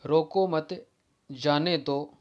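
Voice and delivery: "रोको मत" joined and loud, pause, then "जाने दो"; pause after मत. Shown as a demonstration. Teacher showing the moved semicolon, pause after "मत". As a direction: run "रोको मत" together and break before "जाने दो". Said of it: "यानी मत के बाद में".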